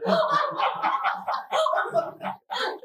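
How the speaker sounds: very high breathy laughter. Real, not acted. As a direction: giggle freely. Speaker laughs.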